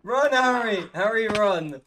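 urgent shout. Real, not acted. Warning his friend Harry to flee, shouting.

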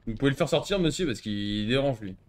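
ordinary talk, nothing marked

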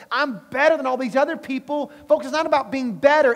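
loud, high, driving sermon delivery; full-force preaching. Acted. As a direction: preach it hard to the congregation.

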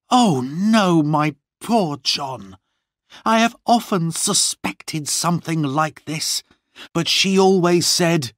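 high-pitched, distressed exclamation; dismayed cry. A narrator's reading. Lorna to John about the lost letters, dismayed cry. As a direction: cry out in sudden realisation. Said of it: "cried Lorna".

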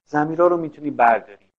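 ordinary talk

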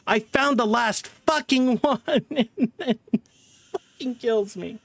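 high pitched, laughing through the words; laughing in disbelief. Real, not acted. Streamer laughing at dying after the last tape. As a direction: laugh helplessly at the bad luck.

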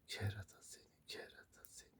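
very soft, almost whispered teasing; fond muttering. Real, not acted. Owner to a little rascal pet, fond murmur. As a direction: tease it under the breath.

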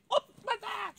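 very high squeaky yelp; startled little mole. Acted. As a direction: squeak as if jumping back. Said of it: "che fece un saltello indietro".

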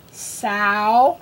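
"Sow" stretched on OW, rising; isolated "Sow", long lifted vowel; drilling OW words. Shown as a demonstration. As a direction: draw out the OW in "Sow" with a lift. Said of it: "words that have ow".

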